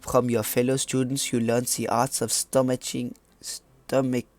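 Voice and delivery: put-on French accent, narrating. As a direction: read it out in a mock French accent. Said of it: "I'm gonna stop doing my French accent now".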